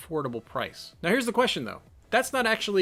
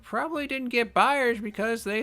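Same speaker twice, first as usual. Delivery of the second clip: put-on high, slow old woman voice; a knowing old lady explaining prices; 8 semitones higher than usual. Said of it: "we can do an old lady voice".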